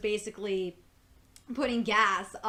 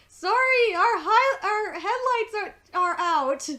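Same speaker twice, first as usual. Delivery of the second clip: couple's apology; 10 semitones higher, 8 dB louder, slower than usual. Retold or imitated. Couple in the car quoted.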